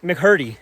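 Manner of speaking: McCurdy said loudly as "mick-HUR-dee"; pronounced with an H. Shown as a demonstration. Hiker settling on the mountain's name, McCurdy with an H. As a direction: breathe an H into McCurdy, "Mc-Hurdy". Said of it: "with a H".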